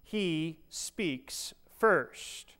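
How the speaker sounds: very slow, every word weighted; slow emphasis. Shown as a demonstration. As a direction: hammer He speaks first home, very slowly. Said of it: "Catch that?".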